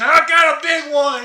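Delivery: a light mock kid voice, showing off; proud kid shout. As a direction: brag about the catch like a kid. Kid proudly calls out his catch.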